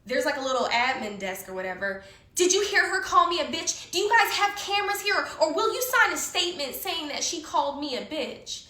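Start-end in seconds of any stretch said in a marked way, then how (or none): 2.3-8.7 tattle like a petty crybaby; mocking crybaby imitation; whiny baby. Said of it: "this little fucking baby".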